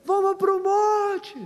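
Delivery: high, slow calling shout. Retold or imitated. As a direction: shout it out slowly. Moses shouting. (grito lento)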